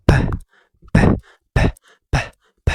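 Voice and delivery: ب as an explosive unvoiced "p"; strong-puff P. Shown as a demonstration. Teacher showing P is not "bee", sharp air bursts. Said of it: "بتطلع هوا جامد من بقك".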